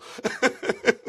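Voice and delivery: real breathy laughing burst. Real, not acted. Guide laughing after Fred's line, a burst of laughter. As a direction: laugh out loud.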